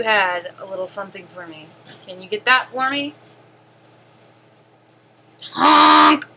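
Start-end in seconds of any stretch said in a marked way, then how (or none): 5.5-6.3 loud clown honk. Acted. Clown honks.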